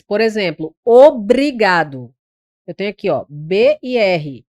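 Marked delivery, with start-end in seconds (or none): 0.8-2.1 OBRIGADO slow and louder, both B and R sounded; duas consoantes pronunciadas. Shown as a demonstration. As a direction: spell out the "br" by ear in OBRIGADO. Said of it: "eu pronuncio as duas consoantes". (OBRIGADO devagar, com o B e o R bem pronunciados)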